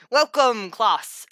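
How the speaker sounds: slipping into a Scottish accent; accidental Scottish. Shown as a demonstration. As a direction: welcome the class in a Scottish lilt. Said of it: "immediately went to Scottish".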